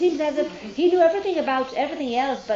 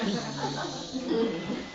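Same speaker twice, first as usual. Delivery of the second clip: soft laugh; 2 semitones lower, 7 dB quieter than usual. Real, not acted. Soft laugh after the punchline.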